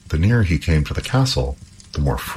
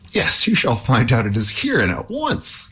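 heroic cry; 8 semitones higher than usual. Acted. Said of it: "cried Halvor".

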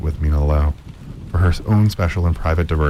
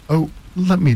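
eager; 11 semitones higher than usual. Acted. Miss Lowe eager.

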